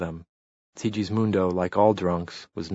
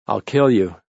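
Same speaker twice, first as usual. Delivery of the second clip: louder menacing threat; drunken threat; 2 semitones higher, 8 dB louder than usual. A narrator's reading. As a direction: threaten him loudly, drunk.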